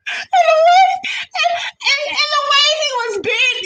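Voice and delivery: high, squealing laughter in the voice; can barely get the words out for laughing. Real, not acted. Laughing at the bent pose.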